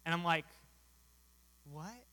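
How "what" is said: quiet, questioning "what"; stunned disbelief. Retold or imitated. Preacher reacting to a weeping man's no, stunned. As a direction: let it out softly after a stunned pause.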